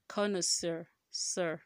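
connoisseur as "kon-uh-sir", then sir alone; correct pronunciation of connoisseur. Shown as a demonstration. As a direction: repeat the last syllable sir of connoisseur. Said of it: "not sir".

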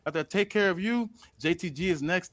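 put-on a half-hearted gangster accent he admits he can't do; Eddie Guerrero impression. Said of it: "He said that was zero seasoning".

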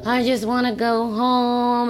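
lightly acted longing to go home; homesick whine. Retelling homesickness to the hosts, drawn-out mock whine.

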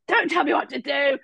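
high, whiny, tearful mock tantrum; petulant snap. Guest snapping at her partner, mock tearful. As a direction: sulk it like a child. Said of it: "I was like".

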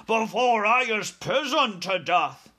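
high, loud giant's wail; panicked shout. A narrator's reading. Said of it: "shouted the flesh lump eater".

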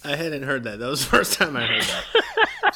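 high, loud words breaking into laughter; real helpless laughing fit, pitch climbing; dying laughing. Real, not acted. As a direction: barely get it out for laughing. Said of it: "I was already dying".

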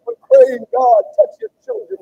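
high, loud prayer cry. Real, not acted. Preacher calling on God for his people, loud high cry. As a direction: call out to God at full voice.

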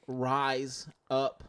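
drawn-out, careful phrase; slow teaching read; slow demonstration. Shown as a demonstration. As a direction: spell it out slowly for him. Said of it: "tell me what it was".

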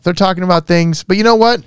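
ordinary talk, nothing marked

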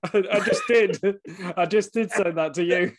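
real laughter, high and loud; laughing. Real, not acted. Host catching the guest saying it to him, laughing loud. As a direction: crack up as you point it out.

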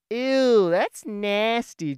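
loud, disgusted little brother's yell, slowing on nasty; kid yelling in disgust. Retold or imitated. Little brother yells at big brother walking ahead, loud and disgusted. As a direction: shout it like a grossed-out little kid. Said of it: "he just yells".

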